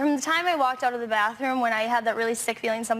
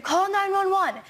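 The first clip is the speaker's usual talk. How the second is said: higher-pitched mock yell, no real volume; retold scream; 7 semitones higher than usual. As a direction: imitate his urgent scream without shouting. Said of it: "and he screamed".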